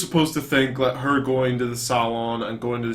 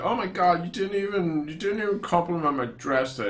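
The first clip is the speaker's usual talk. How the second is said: put-on attention-seeking girlfriend; 5 semitones higher, 4 dB quieter than usual. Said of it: "an attention thing".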